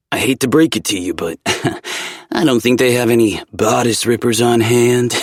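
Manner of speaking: playful friend voice. A narrator's reading. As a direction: tease him with a grin. Zev mocking, laughing.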